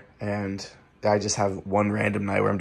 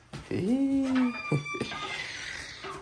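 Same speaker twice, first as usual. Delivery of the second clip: high giggle; 4 dB quieter than usual. Real, not acted. Laughing at pet.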